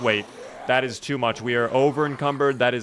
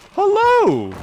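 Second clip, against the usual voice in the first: a sudden high, loud hello; surprised hello; 5 dB louder than usual. Real, not acted. Spotting a ghillie suit zomboid, startled high hello. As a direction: greet the hidden zomboid with a startled squeal.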